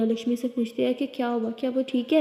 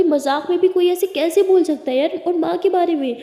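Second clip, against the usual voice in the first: louder and higher, upset; angry argument; 6 semitones higher, 7 dB louder than usual. Acted. Lakshmi refuses Lily's apology about her mother, angry and loud. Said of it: "बहुत इनकी argue चल रही होती है".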